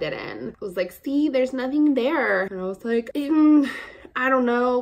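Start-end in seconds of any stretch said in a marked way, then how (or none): 0.9-2.4 high, drawn-out mimicked sales pitch; demonstrating saleswoman. Saleswoman showing the rubbed-in powder to customer, insistent. Voicing saleswoman proving her point. 3.1-4.8 high, loud hesitant hum; doubtful reply. Retold or imitated. Customer unconvinced by the saleswoman's yellow powder, doubtful.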